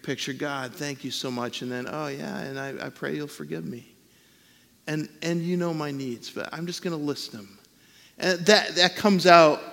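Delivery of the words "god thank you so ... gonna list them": much softer, gentle praying voice; hushed example prayer. Shown as a demonstration. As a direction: pray quietly and humbly.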